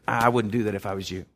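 low, soft voice at your ear; gentle inner warning. Pretend. The Holy Spirit warns a believer, low and soft. Spirit taps you on shoulder.

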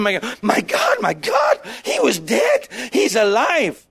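hammed-up amazed exclamations; frantic excitement. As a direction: cry it out like a headless chicken. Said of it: "running around like a headless chicken".

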